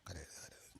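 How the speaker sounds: whispered hush, no voice at all. Retold or imitated. Preacher mocking a priest hushing a crying baby, whispered. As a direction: soothe the baby in a breathless whisper.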